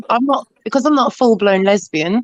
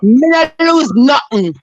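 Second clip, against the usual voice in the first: slow, heavy Jamaican patois, a touch higher and louder; 3 semitones higher, 4 dB louder, slower than usual. Pretend. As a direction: drop into thick Jamaican patois on request. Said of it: "Have you lost your patois?".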